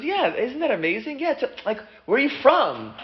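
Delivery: high, eager agreement; excited wonder. Acted. Namesakes compare where they are from, excited wonder. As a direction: marvel along, pitched well up.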